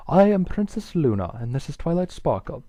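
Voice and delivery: formal princess, projecting; cool, clear voice. A narrator's reading. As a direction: announce yourself coolly and clearly. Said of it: "in a cool, clear voice".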